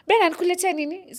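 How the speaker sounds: quick, high, eager asking voice; imitating an eager friend. Friend calling from the supermarket, imitated quick and high. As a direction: chirp it out fast and high like a keen friend on the phone.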